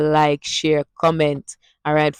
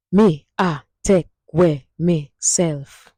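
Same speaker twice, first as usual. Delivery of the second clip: slow, syllable by syllable in Jamaican Patois; slow Patois demo; 3 dB louder, slower than usual. Shown as a demonstration. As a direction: spell the Patois phrase out slowly for learners. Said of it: "Hear that?".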